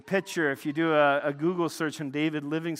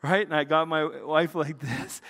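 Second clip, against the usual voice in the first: usual speaking voice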